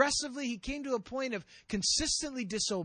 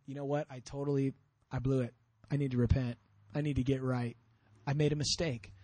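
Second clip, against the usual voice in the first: low, subdued owning up; acted plain-spoken repentance; humble confession; 9 semitones lower than usual. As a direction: admit it soberly, pitch dropped right down. Imagined humble confession by Saul.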